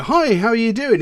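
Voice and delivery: high, loud, breezy mock hello; put-on chirpy small talk; cheery greeting. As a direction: greet like an over-friendly acquaintance. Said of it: "when people turn around and go".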